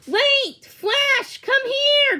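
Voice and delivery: high, loud urgent call. A narrator's reading. Charles calling the running dog, high urgent call. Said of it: "trying to keep his voice low".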